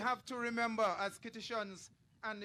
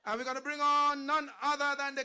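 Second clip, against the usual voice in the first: big build-up, higher and louder into the name; rally announcer; 4 semitones higher, 8 dB louder than usual. Acted. MC bringing on the King at the rally, rally announcer. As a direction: hype the crowd, then shout the name high.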